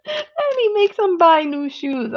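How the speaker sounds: high, breaking laugh through the words; giggling helplessly mid-sentence; cracking up retelling the scene. Real, not acted. Laughing at favorite movie scene.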